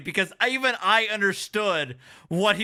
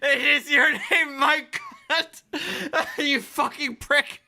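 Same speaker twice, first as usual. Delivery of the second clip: laughing; 6 semitones higher, slower than usual. Real, not acted. Streamer repeats clip line, cracking up.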